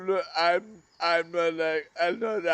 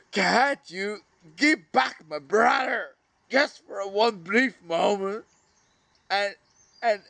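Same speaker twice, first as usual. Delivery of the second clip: raised, pleading cry of grief, much higher and louder; anguished outcry; 7 semitones higher, 6 dB louder than usual. Real, not acted. Grieving brother cries out at life to give him back, anguished shout. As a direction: shout your grief at the sky, begging.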